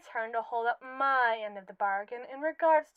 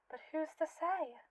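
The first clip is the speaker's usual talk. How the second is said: ordinary delivery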